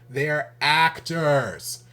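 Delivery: actors punched loud and drawn out; stressed actors. Real, not acted. Exasperated vlogger stressing his point, actors loud and slow. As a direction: hammer the word actors home.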